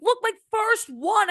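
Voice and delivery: high, slow, excited showing off; childish delight over a first trophy; proud kid. Retold or imitated. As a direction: hold up the trophy and squeal.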